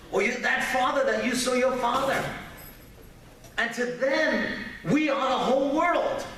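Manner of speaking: high-pitched, insistent sermon delivery; emphatic preaching. Real, not acted. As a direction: press each point on the congregation. Preaches to parents.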